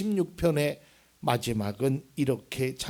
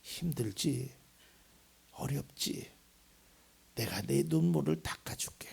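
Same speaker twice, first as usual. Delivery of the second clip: God's tender comfort; 3 semitones lower than usual. Acted. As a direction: gently comfort the weeping one. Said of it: "그러나 하나님의 시선은 그게 아니에요".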